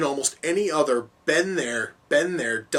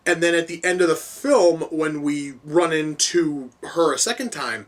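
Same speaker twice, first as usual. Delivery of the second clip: normal talking voice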